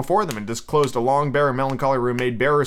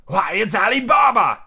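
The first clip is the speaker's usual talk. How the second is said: Scrooge crying out in delight, pitched high; ecstatic exclamation; 7 semitones higher, 4 dB louder than usual. A narrator's reading. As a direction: exclaim it high with childlike joy. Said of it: "Scrooge exclaimed in ecstasy".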